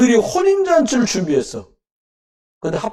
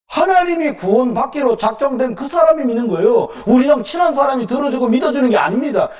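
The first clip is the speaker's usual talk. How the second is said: preaching with force; 4 semitones higher than usual. Acted.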